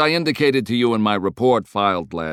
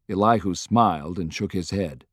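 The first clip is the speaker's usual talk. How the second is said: plain reading voice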